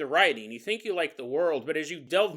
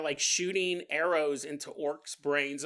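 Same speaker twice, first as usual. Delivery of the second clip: ordinary talk, nothing marked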